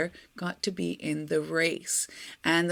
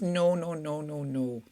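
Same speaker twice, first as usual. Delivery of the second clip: low, falling string of refusals; refusing dog; 4 semitones lower, 3 dB quieter than usual. A narrator's reading. The loyal dog won't leave the pig, firmly refusing. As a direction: sink lower and softer with each word.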